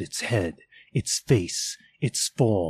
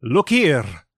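louder, urgent call to look; exclaiming spirit; 4 semitones higher, 6 dB louder than usual. Acted. Spirit urges Scrooge to look, louder call. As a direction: demand his eyes on the children.

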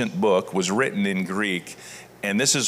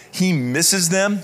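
slow, higher, louder, lingering on He misses them; tender emphasis; 7 semitones higher, 4 dB louder, slower than usual. Shown as a demonstration. Pastor stressing Paul's longing for his church, tender and slow. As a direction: let He misses them ache with fondness. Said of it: "He's thankful for them. He misses them".

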